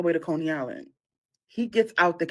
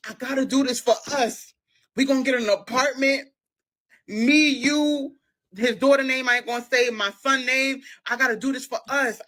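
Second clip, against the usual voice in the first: loud, higher, insistent promises; earnest pleading boyfriend; 7 semitones higher, 5 dB louder than usual. Retold or imitated. Boyfriend promising a life in Harlem, pleading loudly. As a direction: make a big pitch for your future together.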